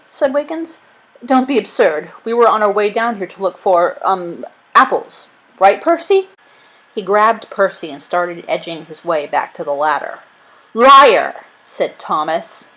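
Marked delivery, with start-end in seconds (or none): 1.2-6.3 flustered bluffing servant. Acted. Caught spy makes excuse. 10.7-11.4 higher, much louder accusing shout; loud accusation. Acted. Accuser exposes the spy.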